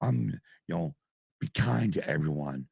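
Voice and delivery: soft, hushed sugary goody-goody voice; mock kind voice. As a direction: play the ever-kind nice guy, mockingly. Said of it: "[name] plays".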